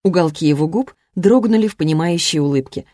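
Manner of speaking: plain reading voice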